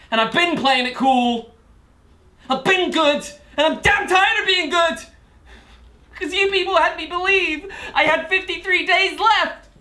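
building to a high-pitched, angry outcry; mounting anger. Acted. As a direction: let pent-up frustration climb into shouted accusation. Defendant's anger builds at the court.

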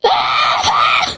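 broken, stuttering shriek; weird scream. Real, not acted. Player hearing pursuers close behind, weird scream. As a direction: let out a cracked scream of alarm. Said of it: "I have the weirdest scream".

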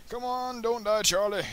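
high, loud begging; desperate plea. Real, not acted. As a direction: beg the crewman not to die. Pleading to dying crewmate.

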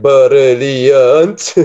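'brilliant' drawn out very slowly as bur-il-ee-ant; split syllables. Shown as a demonstration. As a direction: stretch 'brilliant' into four separate syllables. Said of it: "I can read brilliant as".

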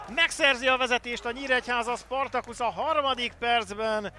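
rising to a roaring goal shout; excited goal call. Real, not acted. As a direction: yell the goal at full voice. Goal called in commentary.

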